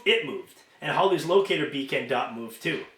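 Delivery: plain reading voice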